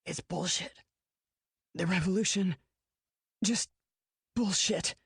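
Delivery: breathless. A narrator's reading. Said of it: "only shallow breaths".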